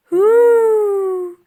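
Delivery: long high loud gasping whoo. Acted. Elsie surfacing from icy water, gasping. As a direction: gasp for air after the ice. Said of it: "Elsie gasped".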